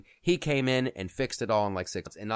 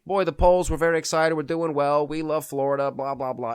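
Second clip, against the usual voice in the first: a loud, hammed-up stump speech; mocking Trump's stump speech; 4 semitones higher, 4 dB louder than usual. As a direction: rattle it off like a tired rally speech. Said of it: "it's always the same speech".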